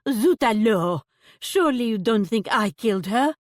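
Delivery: loud Zut alors in fake French; shocked shopkeeper in fake French. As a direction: recoil in put-on French alarm. Shopkeeper recoils.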